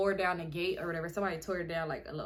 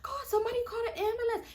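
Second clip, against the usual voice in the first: high, fast tearful plea; imitated crying; faster than usual. Crying girl calling for an ambulance, high hammed-up plea. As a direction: beg for help through mock sobs. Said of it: "I'm crying even more now".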